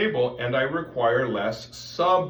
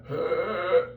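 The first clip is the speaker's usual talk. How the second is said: tight throat; 7 semitones higher than usual. Shown as a demonstration. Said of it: "now my throat gets tight".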